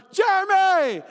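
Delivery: loud, high shout of a name; imitated calling out. Someone hailing a friend at a conference, imitated shout. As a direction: call across a big open room.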